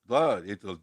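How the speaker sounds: put-on botched Italian grandfather accent; half-hearted Italian accent; Italian accent attempt. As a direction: try an Italian accent and miss. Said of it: "with his Italian accent".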